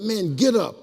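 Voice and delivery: high, loud command to get up; loud taunt. Retold or imitated. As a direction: yell down at the man on the floor. Yelling at fallen opponent again.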